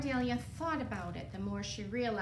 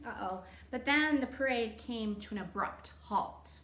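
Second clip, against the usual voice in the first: plain reading voice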